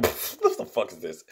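mock snicker, then a low, soft line; slow, hushed, half-hearted imitation of amusement; imitated laughing disbelief. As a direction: puff out a mock laugh, quietly incredulous. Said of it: "starts laughing, like".

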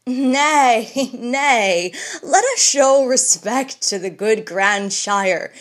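laughing mockery. Bullivant mocking the old man, laughing. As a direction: laugh him off as a joke. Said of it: "said Bullivant, laughing".

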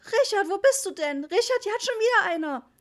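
very high, loud panicked mum; frantic shrieking appeal; desperate shout. A narrator's reading. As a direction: yell for help from the husband. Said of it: "schrie Mama verzweifelt".

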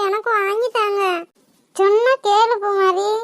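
usual speaking voice

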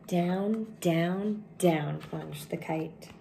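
usual speaking voice

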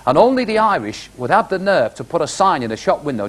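ordinary talk

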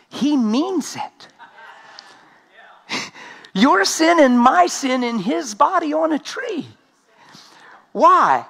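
emphatic preaching. Acted. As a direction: drive the scripture home. Preaching climax.

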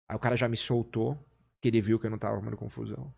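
ordinary talk

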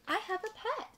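high, thrilled exclamation; high-pitched storybook delight; delighted fish. A narrator's reading. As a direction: squeal it as a little fish overjoyed. Fish thrilled to find a pet.

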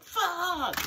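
high, loud imitated "Fuck."; mock shocked curse. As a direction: voice the audience's stunned outburst, way up high. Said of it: "I hear some of you guys like".

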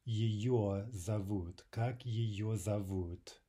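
very slow, measured language-teacher delivery; careful half-speed demonstration; slow model phrase. Shown as a demonstration. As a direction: draw each word out for learners to copy.

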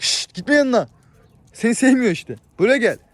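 loud calls. Real, not acted. Man warning his goat away from another, loud and high. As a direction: warn it off, then call it in. Shooing and calling animals.

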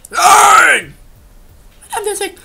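high, loud despairing cry of no; he got away, outraged howl; anguished shout. Real, not acted. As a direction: wail it as he slips away. Target escapes.

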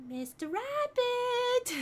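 put-on high, drawn-out little-girl call; high, slow childlike British voice; Alice. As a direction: call out like a very young English girl. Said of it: "where she's a very young little girl".

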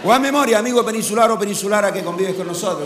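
ordinary delivery

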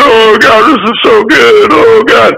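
high-pitched tearful gushing; hammed-up weepy wail; mock crying. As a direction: imitate a crowd weeping over a movie. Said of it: "everybody's crying".

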